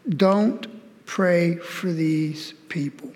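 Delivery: very slow, weighted, word by word; deliberate, spaced-out pronouncement; God's solemn command. Retold or imitated. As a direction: quote a divine order, drawn out slowly. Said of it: "God tells Jeremiah about Judah and Israel".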